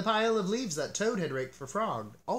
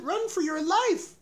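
high, loud rabbit yelling a warning; a rabbit shouting in terror; a high loud frightened shout; panicked shout; 6 dB louder than usual. A narrator's reading. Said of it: "shouted a rabbit".

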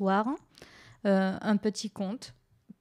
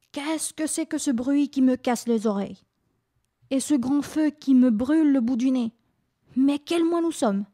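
lightly voiced grumpy old Winter, higher; grumbling old Winter; 4 semitones higher, 3 dB louder than usual. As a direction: grumble like a woken old man. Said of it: "il grommela".

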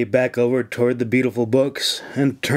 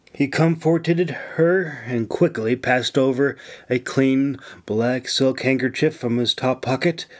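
plain reading voice

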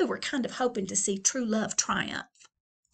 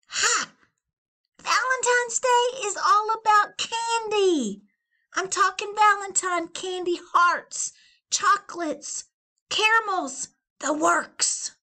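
high, loud and cocky; scoffing Valentine; 11 semitones higher, 6 dB louder than usual. Acted. Valentine to Leon, high and cocky. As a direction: laugh off love, crave candy.

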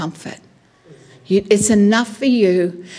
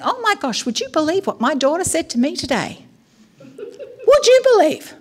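high-pitched, scandalised disbelief; incredulous venting; 5 semitones higher than usual. Acted. Mother venting to God about her daughter, incredulous. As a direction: climb higher in exaggerated disbelief.

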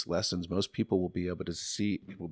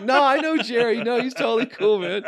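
1 high laughing voice; 11 dB louder than usual. Retold or imitated. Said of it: "I'm like".

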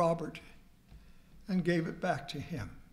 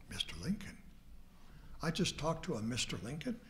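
awed whisper into a quieter, faster question; stunned disbelief; 3 semitones lower, 8 dB quieter, faster than usual. Retold or imitated. Caller hangs up, awed that he spoke to Lincoln, whispering. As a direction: breathe it out, amazed at who you just spoke to.

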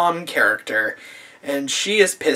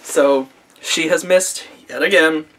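ordinary delivery

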